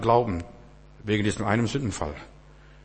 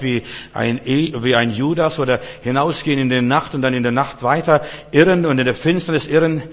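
prayer; 2 semitones higher, 4 dB louder than usual. Acted. Prays aloud.

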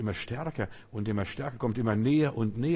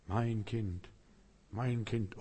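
soft, gentle fatherly call; tender call; 6 dB quieter, much slower than usual. Acted. The Lord speaks softly to his child. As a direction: murmur it like a loving father.

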